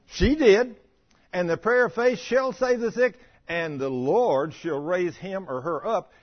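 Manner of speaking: ordinary talk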